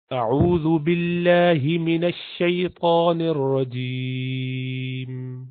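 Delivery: slow, chanted tajweed recitation; Quran recitation. Acted. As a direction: recite slowly with long drawn vowels.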